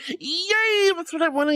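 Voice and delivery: happy cheer. Real, not acted. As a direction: cheer for a friend's good news. Cheering viewer's good news.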